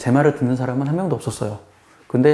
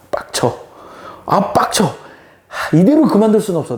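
higher, louder irritated outburst; frustrated gamer; 6 semitones higher, 3 dB louder than usual. Retold or imitated. Gamer losing a match, fuming and louder. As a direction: fume at losing the game.

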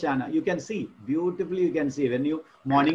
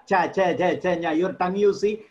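normal talking voice

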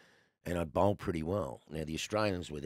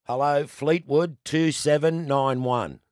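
higher, louder, slow formal phone answer; formal phone greeting; 8 semitones higher, 9 dB louder, slower than usual. Shown as a demonstration. Lodger answers the landlady's phone, slow formal greeting. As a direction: announce the town and number like a proper 1980s phone greeting. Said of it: "you had to answer it like this".

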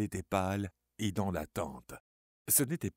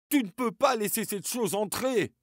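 much higher, loud frantic shout; trembling yell; 12 semitones higher, 7 dB louder than usual. Acted. Charlie yells at Suzanne not to let the thing in. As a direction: yell to stop her opening the door. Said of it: "hurla Charlie, tremblant".

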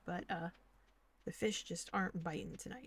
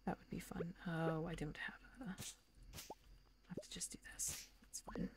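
whispering softer to herself; real whisper; 8 dB quieter than usual. Real, not acted. Player muttering over her farm, whispered. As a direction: breathe the words, half to yourself.

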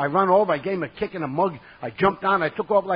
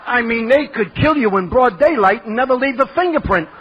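loud, high comic outrage; retold exasperated rant; indignant outburst; 5 semitones higher, 6 dB louder than usual. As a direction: protest loudly to a friend, pitch climbing.

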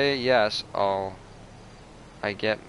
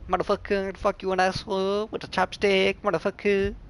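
higher-pitched crude mock Chinese accent; teasing friends in a hammed-up Chinese accent, pitched higher; 8 semitones higher than usual. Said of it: "The Chinese".

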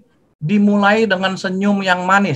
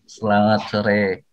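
a slow, low "Selamat sore" with each syllable clear; slow clear greeting; 6 semitones lower, slower than usual. Shown as a demonstration. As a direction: say "Selamat sore" gently and without hurry. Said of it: "Nah, gitu ya". ("Selamat sore" diucapkan pelan dan jelas)

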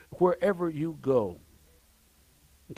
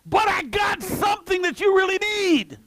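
much higher, shouted proclamation; Peter's bold declaration. Retold or imitated. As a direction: shout it out like the apostle to the beggar. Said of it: "That's exactly what Peter and John said".

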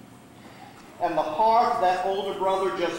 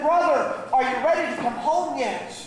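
higher-pitched pleading question; urgent appeal; 7 semitones higher than usual. Acted. Older brother calling once more, pleading. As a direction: press him, voice lifted.

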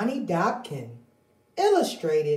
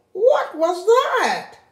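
high, slow, puzzled hen voice; startled hen; 12 semitones higher, 4 dB louder, slower than usual. Acted. Henny Penny just hit by an acorn, high and alarmed. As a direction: look around in alarm.

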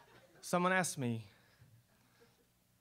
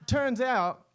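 loud, high punch on the setup; dramatic reveal; 7 semitones higher, 7 dB louder than usual. Acted. Insider revealing a showbiz secret, loud and high. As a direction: hit it like a big announcement.

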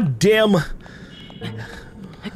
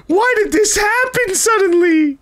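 higher alarmed disbelief; shocked; 8 semitones higher than usual. Real, not acted. Streamer suddenly burning in the heat, higher alarmed disbelief. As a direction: cry out in confused alarm.

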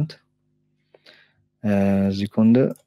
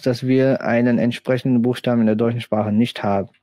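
ordinary delivery

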